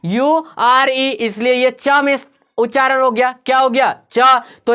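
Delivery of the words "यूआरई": slow spelling. Shown as a demonstration. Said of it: "आ गया यूआरई".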